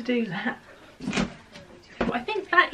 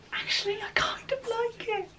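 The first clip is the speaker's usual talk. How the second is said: whisper; 13 semitones higher than usual. Real, not acted. Trying a top on.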